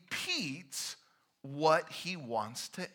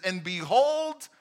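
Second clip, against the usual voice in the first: much louder, declaring; ringing announcement; loud proclaiming; 4 semitones higher, 9 dB louder, slower than usual. Pretend. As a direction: proclaim it to the room. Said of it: "He says".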